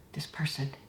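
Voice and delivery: hushed mock-horrified whisper; horrified shudder. Architect confiding his horror at tea with fish, hushed mock whisper. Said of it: "And he was shuddering".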